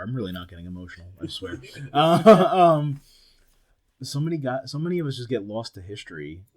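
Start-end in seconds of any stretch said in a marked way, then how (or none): none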